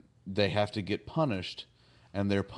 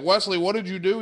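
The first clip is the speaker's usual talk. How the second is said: high, louder mock alarm; alarmed demand; 8 semitones higher, 5 dB louder, faster than usual. Crew runs up to Wesley, alarmed. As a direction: imitate a frantic adult questioning a kid.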